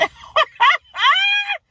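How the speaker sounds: a sudden high shrieking burst of laughter; a real squealing laugh. Real, not acted. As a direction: crack up loudly at your own botched accent. Cracking up at own accent.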